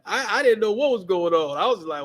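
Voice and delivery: ordinary delivery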